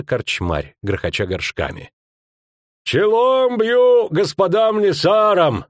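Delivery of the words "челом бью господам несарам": slow, higher, louder hail; booming greeting. Acted. Skomlik greeting the men at the table, booming slow hail. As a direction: boom it across the tavern. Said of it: "загудел Скомлик".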